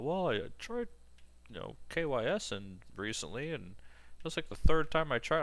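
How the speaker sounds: imitating his friend's breezy confession; casually retold friend. To the narrator, an old friend shrugging off his attempt, high and casual. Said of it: "when he's like".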